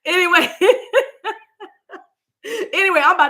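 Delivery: high, loud, quick burst of real laughter; laughing. Real, not acted. Laughing off her crazy vision, real giggling fit. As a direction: crack up while trying to move on.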